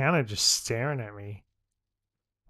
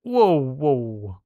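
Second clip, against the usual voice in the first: loud, higher indignant protest; 4 semitones higher, 8 dB louder, slower than usual. Real, not acted. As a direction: push back loudly, half laughing. Banter argument over being wet.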